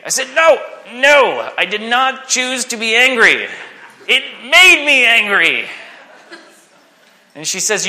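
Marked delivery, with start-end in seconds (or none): 0.3-5.7 put-on higher, louder teen protest, laughing at the end; stubborn protest. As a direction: insist like a kid who won't admit fault. Teen arguing with aunt.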